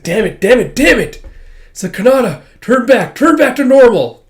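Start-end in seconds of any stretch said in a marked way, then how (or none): 0.0-1.2 loud, high cursing that builds; acted frantic outburst; frantic frustration. Alma fails to pull out stake. 1.9-4.2 high, loud shouted plea; acted frantic appeal; desperate pleading. Alma begs Kanata to turn back.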